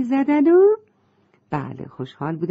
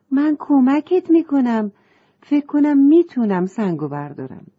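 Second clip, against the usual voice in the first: eager, higher offer to help; hurried; 7 semitones higher, 4 dB louder than usual. A narrator's reading. Girl hurriedly offers to help the fish, eager. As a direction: rush in to help. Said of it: "با عجله گفت".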